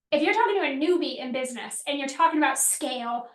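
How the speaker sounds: ordinary talk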